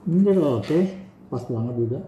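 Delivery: muffled behind respirator; speaking through filter mask; through a face mask. Real, not acted. Seen 0: Half-face respirator covers his nose and mouth throughout the labelled words.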